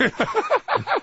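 high giggling burst; real laughter. Real, not acted. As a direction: crack up helplessly at your own joke. Host cracks up at own gag.

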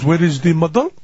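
put-on Italian accent, rising question; mock Italian accent. Makeup man asking the comic himself, mock Italian accent. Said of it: "I'm gonna be doing an accent here".

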